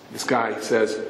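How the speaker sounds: usual speaking voice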